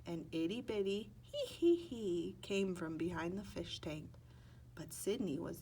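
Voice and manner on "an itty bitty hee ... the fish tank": ordinary delivery, nothing marked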